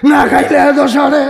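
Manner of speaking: high, loud indignant shout; mock-scandalised outburst; acted outraged echo. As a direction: throw the words back, pitched high. Wife's outraged echo of his words.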